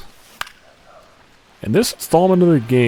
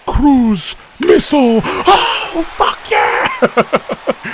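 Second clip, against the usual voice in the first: too excited; 10 semitones higher, much slower than usual. Real, not acted. Streamer celebrating a homer he called early, ecstatic yell. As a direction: explode with real joy at the hit. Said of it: "Sorry, I got a little too excited".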